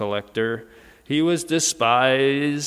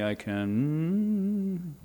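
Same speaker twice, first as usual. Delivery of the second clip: "I can" trailing into a long, softer, higher held "can"; unfinished sentence prompt; 4 semitones higher, 6 dB quieter than usual. Shown as a demonstration. Preacher repeats the fill-in prompt to congregation, "can" left hanging. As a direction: hold "can" open on a hum for listeners to finish. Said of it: "how would you continue this sentence".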